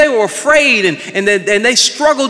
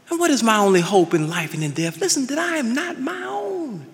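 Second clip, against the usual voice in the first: ordinary delivery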